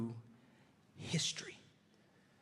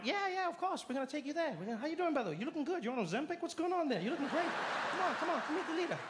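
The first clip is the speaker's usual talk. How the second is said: higher, bubbly schmoozing chatter; eager greeter; 11 semitones higher, faster than usual. Retold or imitated. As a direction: flatter the alien while hurrying him along. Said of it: "we're like".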